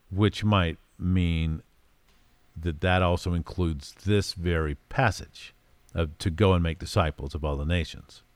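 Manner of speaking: usual speaking voice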